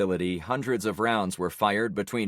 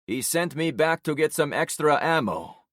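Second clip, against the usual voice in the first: Alvaro's voice; 7 semitones higher, 3 dB louder than usual. Acted.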